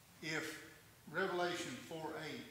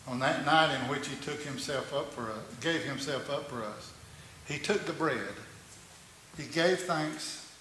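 loud, fervent pulpit preaching; preaching at full force; 12 dB louder than usual. Acted. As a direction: proclaim it to the whole church.

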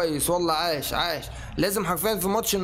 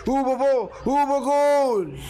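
goal yell; 6 semitones higher, much slower than usual. Real, not acted. Gamer scoring again with Neymar, a long ecstatic yell. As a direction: let the goal cry ring out.

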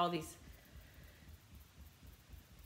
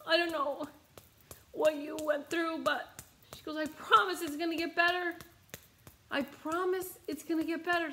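a stranger consoling a sobbing woman on the roadside; gentle comforting stranger; 7 semitones higher than usual. Retold or imitated. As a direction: soothe a crying person, gently. Stranger comforting her while she cried.

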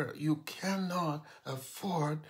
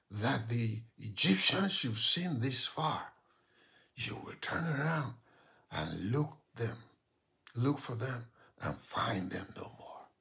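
whispered prayer; 2 semitones lower than usual. Real, not acted. Pastor praying victory over the listener, whispered.

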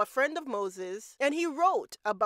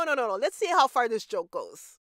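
ordinary talk, nothing marked

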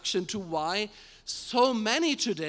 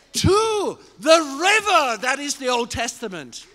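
preaching at full force; 5 semitones higher, 7 dB louder than usual. Real, not acted. Preacher proclaiming the mission, full-force preaching. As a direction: ring it out to the whole church.

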